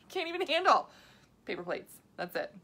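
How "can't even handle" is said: high laughing burst, louder; laughing repeat. Real, not acted. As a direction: laugh it out, much higher.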